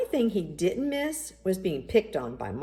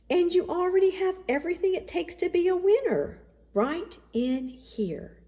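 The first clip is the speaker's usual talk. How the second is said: encouraging father; 12 semitones higher than usual. A narrator's reading. Daddy reassuring Michael about his heart, warm and slowing.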